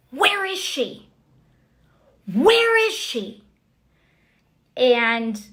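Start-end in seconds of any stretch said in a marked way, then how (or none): 0.1-3.4 high yelled search call; retold yelling. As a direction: yell it, hunting for someone. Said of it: "I just start to hear these yells".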